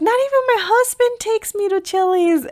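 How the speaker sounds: amused friend teasing, voiced up high; laughing coworker. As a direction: imitate the coworker through giggles. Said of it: "She's like".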